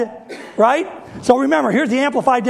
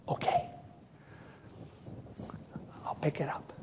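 softer, whispered surrender; defeated whisper; 6 semitones lower, 19 dB quieter than usual. Acted. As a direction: give in under your breath. Moses gives in, defeated.

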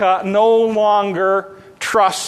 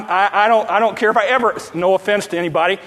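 ordinary talk

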